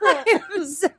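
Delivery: high-pitched, breaking into giggles; real laughing apology. Real, not acted. Apologising to co-host mid-reading, cracking up. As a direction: apologise through helpless laughter.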